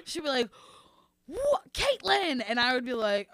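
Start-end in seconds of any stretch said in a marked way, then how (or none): 1.3-2.4 high, slow, drawn-out mock scolding; exasperated nun. Nun reacting to pupil's giant sneeze, exasperated mock scold. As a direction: stretch the name in weary reproach.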